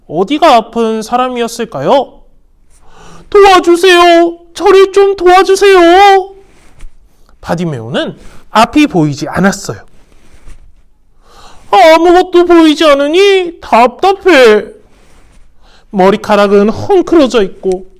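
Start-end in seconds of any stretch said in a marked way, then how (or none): 3.3-6.3 high, loud, slow begging cry; blind beggar pleading. Acted. Blind Bartimaeus begging passersby, high loud plea. 11.7-14.8 high, loud, exasperated complaint; frustrated blind beggar. Acted. Blind Bartimaeus lamenting his blindness, high frustrated voice.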